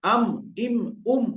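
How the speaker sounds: "আম", "ইম", "উম" each closed on a hummed m; wet-lip meem. Shown as a demonstration. Teacher drills meem, wet-lip "আম", "ইম", "উম". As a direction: press inner lips together for "আম", "ইম", "উম". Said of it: "ভিজে অংশ থেকে মিম".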